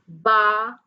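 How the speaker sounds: BA held long, set apart; syllable by syllable. Shown as a demonstration. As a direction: stretch out BA as a separate syllable. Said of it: "put your hand like this".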